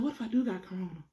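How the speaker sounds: quiet, fast worried question; panicky. Retold or imitated. Asking herself if she has corona, quietly panicking. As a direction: rush out the fear under your breath.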